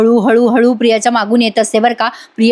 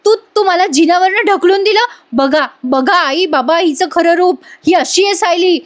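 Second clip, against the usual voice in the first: higher, dramatized outrage; acted indignant accusation; 6 semitones higher than usual. Priya blames Sayli before the family, acted indignation. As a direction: imitate an aggrieved accuser appealing to onlookers.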